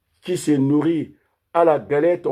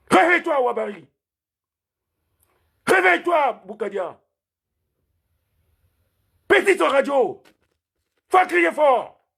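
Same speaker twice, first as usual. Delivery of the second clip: rallying shout; 9 semitones higher than usual. Real, not acted. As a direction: cry out to rouse the Congolese. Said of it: "il faut qu'on crie très fort".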